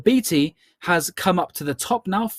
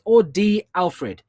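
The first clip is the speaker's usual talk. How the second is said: normal talking voice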